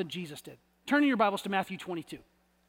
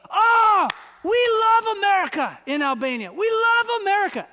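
loud, high, overjoyed shout of an Albanian man; excited old man; 9 semitones higher, 6 dB louder than usual. Retold or imitated. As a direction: belt it out with delight, much higher. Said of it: "and he goes".

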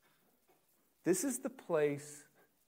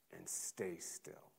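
slow hush; 6 semitones lower, 12 dB quieter, much slower than usual. Acted. Jesus calming the storm.

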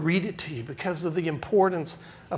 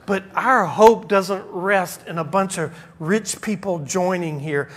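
ordinary talk, nothing marked

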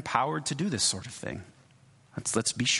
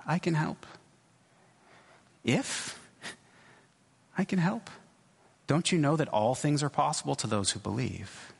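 mildly affronted Jesus. Retold or imitated. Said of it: "almost as if he's affronted".

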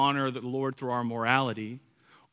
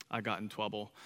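put-on small, low, sheepish child's admission; four-year-old son; 4 semitones lower, 9 dB quieter than usual. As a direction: imitate a little boy quietly confessing. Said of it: "our four-year-old".

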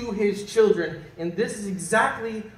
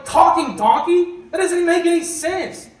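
much higher and louder, laughing in disbelief; incredulous scoffing; 9 semitones higher, 6 dB louder than usual. Retold or imitated. Preacher mocking his own doubt to the congregation, incredulous and laughing. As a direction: scoff at a talking donkey, laughing. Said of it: "No, I go".

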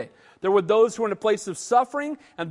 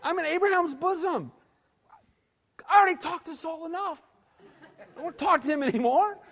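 higher, laughing mock-whiny Samuel; reluctant Samuel; 6 semitones higher, 4 dB quieter, faster than usual. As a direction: play Samuel as a grumbling saint who'd rather stay put. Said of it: "Samuel's like".

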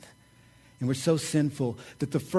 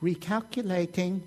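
a lightly voiced, higher robotic GPS word; satnav voice; 4 semitones higher, 5 dB quieter than usual. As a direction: say it like the navigation lady. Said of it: "And what'd she say?".

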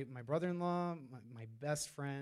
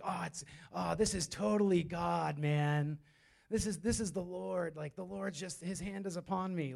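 higher, put-on voice of gushing certainty; mock overconfident believer; 3 semitones higher than usual. Preacher imitates someone sure of God's promise, mock-awed. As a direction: play the awed believer, lightly hammed up.

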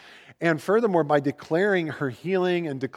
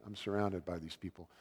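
low, much softer, weary aside; a mock exasperated sigh of a line, lower and quieter; exasperated eye-roll; 7 semitones lower, 12 dB quieter than usual. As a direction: mutter it under your breath as if fed up with everyone. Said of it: "Jesus kind of rolling his eyes at them".

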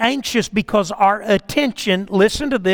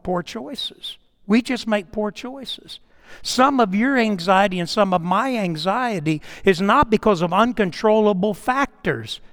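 usual speaking voice

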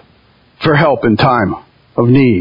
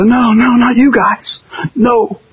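higher pretend protest from others; mock protest; 6 semitones higher, slower than usual. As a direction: play the startled outsider pushing away. Imagined outsiders reacting.

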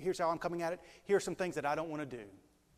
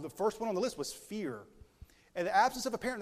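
ordinary talk, nothing marked

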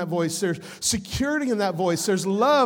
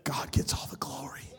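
whispered, reverent aside; hushed praise; 9 dB quieter than usual. Real, not acted. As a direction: drop to a near whisper. Hushed praise after the joke.

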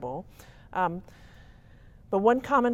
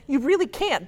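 usual speaking voice

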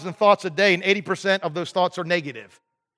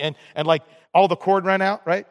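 ordinary delivery

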